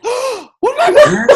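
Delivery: high, slow mock shout; imitated shocked outburst. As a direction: blow up in mock horror, drawn out high and loud. Acting out friend's shocked outburst.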